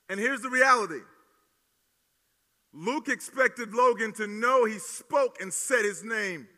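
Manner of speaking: loud, slow, emphatic preaching. Acted. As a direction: hammer each word home from the pulpit. Pastor driving home point.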